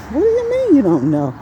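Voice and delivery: high, disbelieving comeback; incredulous retort. Retold or imitated. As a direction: throw the question back in disbelief. Retold argument with store employee.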